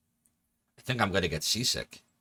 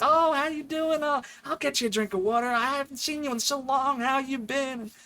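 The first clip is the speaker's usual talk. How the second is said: much higher, sing-song friendly mom; mom's voice. Retold or imitated. Mom greeting her son's shy friend in the kitchen, high and gushing. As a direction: gush like a delighted mother greeting a guest. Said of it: "And she's like".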